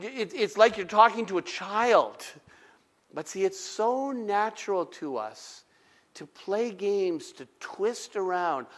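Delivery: ordinary delivery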